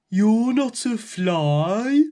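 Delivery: slow, loud booming giant; big giant voice. A narrator's reading. Giant feels something in his mouth, slow loud booming voice. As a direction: boom it out like a sleepy giant. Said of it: "the big voice said".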